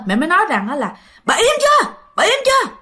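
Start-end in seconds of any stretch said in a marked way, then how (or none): 1.2-2.7 snapping shout. Retold or imitated. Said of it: "mẹ mới quát lên".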